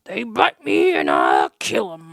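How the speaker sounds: slow, low, blunt; the dog-hater doubling down, low and slow; grim old man. Retold or imitated. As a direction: say it slowly with grim finality. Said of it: "he's like".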